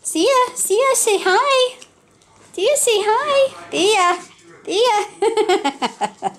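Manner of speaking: high sing-song calling a puppy; puppy coaxing. Real, not acted. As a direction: call her name high and sweet.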